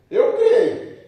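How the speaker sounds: high, loud burst of mock enthusiasm; excited. Preacher acting out the father claiming he believes, excited. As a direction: blurt it out all fired up, half laughing. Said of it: "Aí ele ficou todo empolgado".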